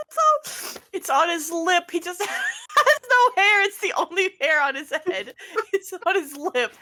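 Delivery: high, helpless real laughter through the words; laughing protest. Real, not acted. Game master correcting player about the mustache, laughing protest. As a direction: explain while cracking up.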